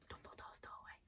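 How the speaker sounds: hushed, unvoiced whisper. Real, not acted. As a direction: breathe the name out in an awed whisper. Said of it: "Why am I whispering?".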